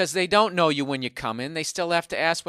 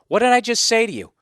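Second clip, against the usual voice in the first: retold scolding, raised and loud; indignant confrontation; 7 semitones higher, 6 dB louder than usual. Customer confronting clerk who scanned anyway, mildly indignant. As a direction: call him out, higher and louder.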